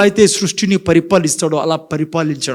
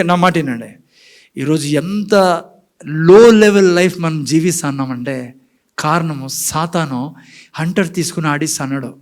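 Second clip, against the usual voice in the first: normal talking voice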